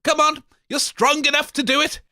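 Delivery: ordinary talk, nothing marked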